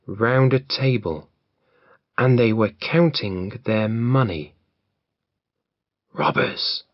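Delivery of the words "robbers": breathy unvoiced whisper. Jack whispers to his friends at the window, hushed whisper. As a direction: whisper it so the robbers won't hear. Peeping at robbers, must be silent.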